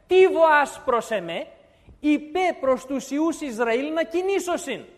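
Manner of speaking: slow, loud proclamation; booming scripture. Acted. God rebukes Moses at the Red Sea, slow and loud. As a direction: declaim God's rebuke to Moses slowly. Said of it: "του είπε".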